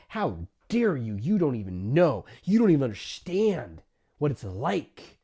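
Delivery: a hint of an offended client; mock angry retort. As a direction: snap back offended. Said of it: "You might even feel angry".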